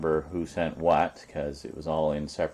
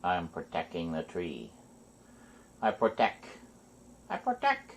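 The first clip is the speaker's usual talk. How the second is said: cat's voice; 4 dB quieter than usual. Pretend. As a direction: voice the cat guarding the tree. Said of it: "She is its protector".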